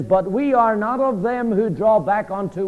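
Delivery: "we" hit hard at the start, the rest carried along; stress on we. Shown as a demonstration. Preacher stressing the word "we" to the congregation, firm stress. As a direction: lean on the word "we". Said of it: "he uses the word "we" in an emphatic sense".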